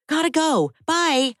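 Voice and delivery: rushing off the phone, out of breath; breathless. Acted. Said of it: "in a breathless voice".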